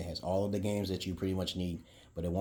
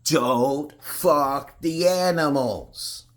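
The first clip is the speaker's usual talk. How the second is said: drawn-out punchline; 8 semitones higher, 10 dB louder, much slower than usual. Pretend. Punchline dragged out.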